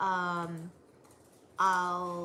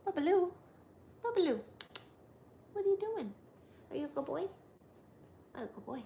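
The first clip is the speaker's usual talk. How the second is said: talking to a dog; 6 semitones higher, much faster than usual. Real, not acted. Baby talk to dog.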